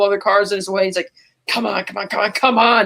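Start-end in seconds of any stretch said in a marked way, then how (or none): 1.5-2.8 a taunting Joker voice, coaxing the rider in. Retold or imitated. Host retelling Joker goading Batman, in a taunting Joker voice. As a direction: goad him on, lightly voicing the Joker.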